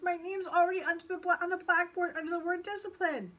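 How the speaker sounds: high-pitched anxious refusal; protesting boy. A narrator's reading. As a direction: protest to your tempter. Paul resists the pigtail.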